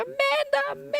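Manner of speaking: high, lilting call of a name; delighted calling. Retold or imitated. Aunt greeting the running toddler, high delighted call. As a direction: sing the name out to the running child. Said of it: "I'd go".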